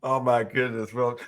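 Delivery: usual speaking voice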